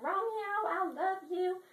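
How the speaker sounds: high, hammed-up lovesick cry; Juliet. Girl on the balcony confessing her love, high mock swooning. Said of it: "she's, like, confessing her love".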